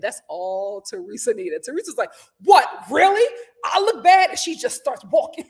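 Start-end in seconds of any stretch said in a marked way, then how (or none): 2.4-4.3 loud, raised challenge; acted affront. Teresa provoked by Margaret's insult, loud affronted challenge. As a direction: throw it back as an offended question.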